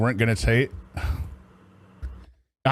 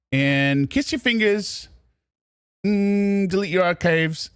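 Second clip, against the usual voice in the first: mock kiss-off; 6 semitones higher, slower than usual. Teasing outro to viewers.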